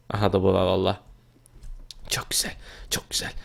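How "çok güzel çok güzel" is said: hushed whisper to himself. Real, not acted. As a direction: whisper it so nobody hears. Sneaking, pleased with hiding spot.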